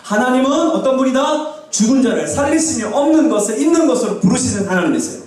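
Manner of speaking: high, ringing sermon declaration; full-force preaching. Real, not acted. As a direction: proclaim it for the congregation to repeat.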